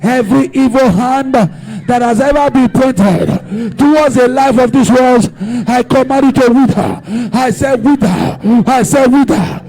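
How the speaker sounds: loud, rhythmic chant of commands; commanding prayer. Acted. Preacher leading the church in prayer, loud commanding chant. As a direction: hammer each wither out like a decree. Leads shouted prayer against evil.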